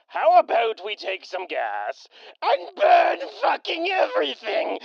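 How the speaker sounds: shouting then cackling high; manic laugh. Acted. A raider cackling over his plan to burn everything. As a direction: go unhinged at the end.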